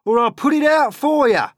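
high, very loud threat; threatening centipede shout. A narrator's reading. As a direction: yell the threat at the glowworm. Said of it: "shouted the centipede".

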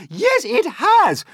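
very high, loud, stretched retort; shouted insistence. A narrator's reading. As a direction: insist at the top of your voice. Aunts bickering loudly about the peach.

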